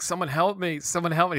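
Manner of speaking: high-pitched pleading call; mock cry for help. Fan retells a character clutching his chest, mock pleading. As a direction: call out for rescue, playfully overdone.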